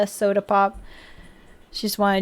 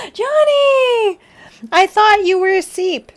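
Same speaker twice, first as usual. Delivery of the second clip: high, delighted cry of a name, stretched out; excited greeting; 10 semitones higher, 3 dB louder than usual. Real, not acted. Streamer greets a viewer thought asleep, high and excited. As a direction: gasp and squeal it, much higher.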